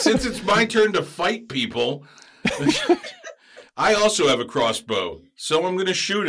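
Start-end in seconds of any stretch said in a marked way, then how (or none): 2.4-3.3 very high squeal of a laugh; squeaky laugh. Real, not acted. Player squealing with laughter on his turn. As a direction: let a squeaky laugh escape.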